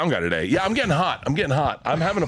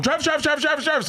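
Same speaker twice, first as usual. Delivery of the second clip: high, carrying call of a name; faster than usual. Retold or imitated. Reporter calling to a player over the others. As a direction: yell to get his attention. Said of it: "when I was going".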